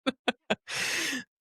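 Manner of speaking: real amused laughter; high laugh. Real, not acted. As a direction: let a delighted laugh spill out. Laughing after the family story.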